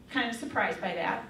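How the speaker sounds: normal talking voice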